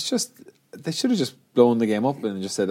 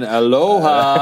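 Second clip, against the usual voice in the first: Hawaiian greeting; 2 semitones higher, 4 dB louder, much slower than usual. Retold or imitated. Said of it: "the Hawaiian tight head".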